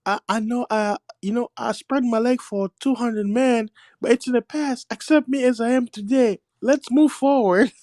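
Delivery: high-pitched mock female voice; pleading woman. Playing a woman asking acceptance despite her past, high mock voice. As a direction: imitate a woman excusing herself. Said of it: "she'll be like".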